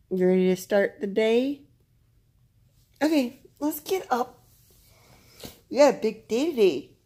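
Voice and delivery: high, lively pet-directed voice; pet talk. Real, not acted. Rousing her pet to start day.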